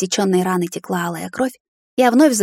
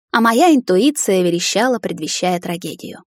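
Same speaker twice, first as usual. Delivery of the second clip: plain reading voice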